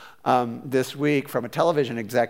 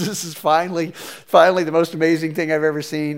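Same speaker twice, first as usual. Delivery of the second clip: ordinary talk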